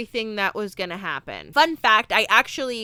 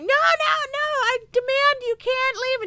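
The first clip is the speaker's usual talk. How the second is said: put-on much higher, boyish desperate pleading. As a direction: beg like a kid refusing to let go. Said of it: "John Connor's like".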